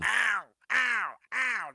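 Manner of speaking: high, loud mock whimpering ow; mock pained ow. Imitating the friend groaning with back pain. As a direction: whine out the ows in mock pain.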